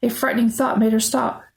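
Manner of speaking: ordinary delivery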